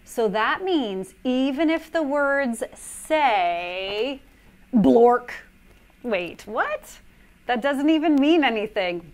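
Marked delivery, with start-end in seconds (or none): 4.7-5.3 blurted nonsense word; silly nonsense word. Acted. Reader forced to say the book's word, blurted. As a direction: pop the word out, playful.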